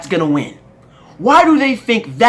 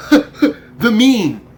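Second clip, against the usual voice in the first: scornful laugh; 2 semitones higher, 4 dB louder than usual. Real, not acted. Mocking the meme ad.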